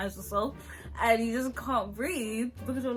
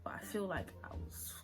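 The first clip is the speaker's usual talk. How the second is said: whisper; 3 semitones lower, 11 dB quieter than usual. Real, not acted. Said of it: "something's not working out in my brain".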